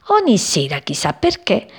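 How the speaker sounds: usual speaking voice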